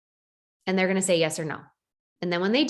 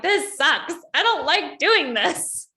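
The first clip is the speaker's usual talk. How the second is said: mock whine; 5 semitones higher, slower than usual. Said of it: "I'm like".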